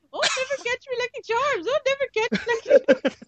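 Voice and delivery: high, hammed-up Irish leprechaun voice; mock Irish leprechaun accent. Teasing friend imitates the cereal leprechaun in mock Irish accent. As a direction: squeak it like a cartoon Irish leprechaun. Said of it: "He'll keep it on ... listen to it".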